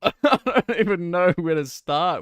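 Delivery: laugh-filled and flustered. Real, not acted. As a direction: start off laughing, at a loss. Laughs at impression request.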